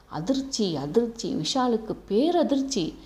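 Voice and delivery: usual speaking voice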